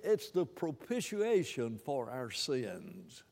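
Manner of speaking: ordinary talk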